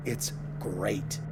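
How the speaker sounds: very low, soft confession; low, quiet, awed approval; hushed verdict. A narrator's reading. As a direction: drop right down and confide it. Phil confides verdict on the cronut.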